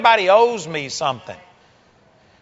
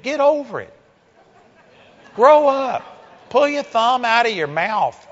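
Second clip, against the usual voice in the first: blunt scolding; 4 semitones higher than usual. Acted. Preacher scolds congregation bluntly.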